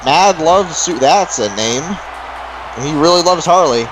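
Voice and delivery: usual speaking voice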